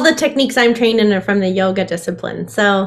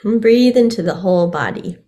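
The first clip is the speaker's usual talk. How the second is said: guided meditation; 7 dB quieter than usual. Acted. Guides a breathing exercise.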